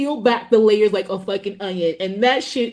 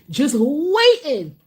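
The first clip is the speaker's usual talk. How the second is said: "waiting" stretched slowly; drawn-out emphasis; much slower than usual. Real, not acted. Creator on people waiting to hate, drawn-out emphasis on "waiting".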